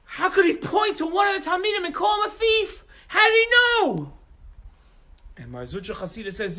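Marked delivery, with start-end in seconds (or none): 0.0-4.1 loud, high indignant cries; outraged shout. Acted. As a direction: protest in disbelief. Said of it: "And everybody says".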